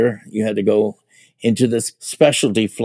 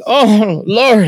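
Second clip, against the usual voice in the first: higher, louder dismayed cry; horrified oh Lord; 11 semitones higher, 5 dB louder than usual. Retold or imitated. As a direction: groan at the end of the world. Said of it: "everybody was like".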